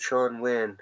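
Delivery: ordinary delivery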